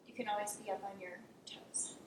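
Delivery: normal talking voice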